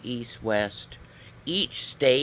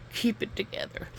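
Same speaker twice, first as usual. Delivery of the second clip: a quick whisper to herself to regroup; whispered self-talk; 9 semitones higher, 5 dB quieter than usual. Real, not acted. Losing her train of thought, she whispers to herself. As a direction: breathe it out under your breath.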